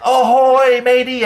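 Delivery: high pirate hail called back; yelled reply. A narrator's reading. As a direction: holler back like a kid playing pirate. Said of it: "Jeff yelled back".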